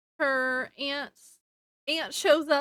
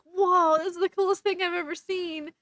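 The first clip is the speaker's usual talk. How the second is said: put-on higher, louder amazed kid; awed excitement; 5 semitones higher, 4 dB louder than usual. Fred reacting to the lightning from her hands, amazed. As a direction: gush like an impressed prankster.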